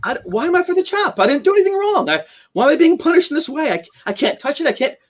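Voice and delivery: distressed. Retold or imitated. Panicked inner monologue after attack.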